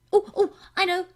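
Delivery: high, loud, bursting little page; a child desperate to answer first; eager cry. A narrator's reading. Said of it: "cried the little page".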